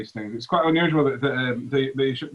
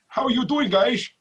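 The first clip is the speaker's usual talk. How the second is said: higher-pitched mock Scottish movie-star voice; Sean Connery; 9 semitones higher, 3 dB louder than usual. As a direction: put on a slurred-S Scottish actor impression. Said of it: "a Sean Connery accent".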